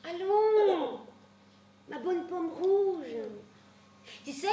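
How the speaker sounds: plus haut, ma bonne pomme rouge ralenti; câliner la vieille nourrice; tendre consolation. Acted. (Antigone console sa nourrice, tendre)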